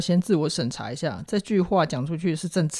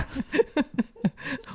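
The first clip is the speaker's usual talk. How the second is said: burst of laughter; 9 semitones higher, 6 dB quieter than usual. Real, not acted. Laughs at the retort.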